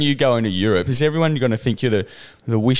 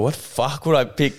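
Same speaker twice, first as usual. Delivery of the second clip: ordinary talk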